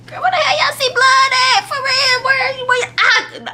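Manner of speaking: high-pitched, hammed-up disbelief; mock alarm. Family reacts to talk of blood, high mock alarm. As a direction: question frantically in a very high voice.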